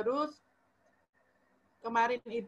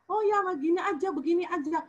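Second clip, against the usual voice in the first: high, lively mock advice-giving; breezy advice; 7 semitones higher, 3 dB louder than usual. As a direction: dish out easy advice, pitched up. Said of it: "kayaknya kita ngasih sarannya gampang gitu".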